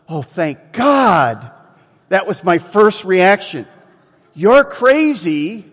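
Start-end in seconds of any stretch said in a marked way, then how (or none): 0.1-1.5 loud, drawn-out relief; exaggerated relief. Preacher's first reaction to her answer, loudly relieved. 4.3-5.7 loud, high, drawn-out mock disbelief; incredulous outburst. Preacher reacting to woman's absurd answer, loudly incredulous.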